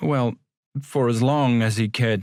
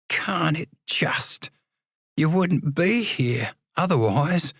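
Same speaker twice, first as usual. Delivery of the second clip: whispered inner voice; 6 semitones higher, slower than usual. Acted. Inner voice answering his thought.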